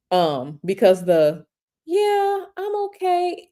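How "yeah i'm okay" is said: high, soft and nurturing; gentle nurturing mom. Retold or imitated. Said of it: "it's soft".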